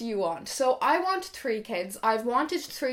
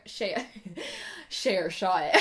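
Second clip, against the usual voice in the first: normal talking voice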